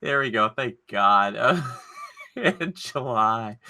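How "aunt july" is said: very slow, chuckling; laughing. Real, not acted. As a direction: savor the joke through a chuckle. Repeats joke laughing.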